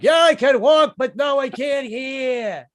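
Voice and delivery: high loud yell fading to a slow grumble; yelling back. Retold or imitated. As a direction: shout back, then drag out the complaint. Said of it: "They're like".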